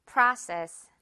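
Process with an open ah; model word for repeating. Shown as a demonstration. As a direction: say Process clearly for the class. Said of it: "입 크게 한 번 더요".

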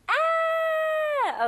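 very high, loud, sharp scream; shrieked "ah" held on one high note; demo scream. Shown as a demonstration. Teacher showing what scream means, piercing demo scream. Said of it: "소리가 좀 높고 거기다가, 어, 좀 날카롭게".

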